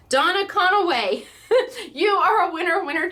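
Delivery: high, loud announcement with laughter; laughing, celebratory name call; delighted winner announcement. Real, not acted. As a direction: announce the winner with real glee. Announces a longtime follower as winner.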